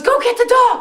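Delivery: high, loud command to the witch; urgent order. Retold or imitated. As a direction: snap out the order, sending her running. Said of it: "And she goes".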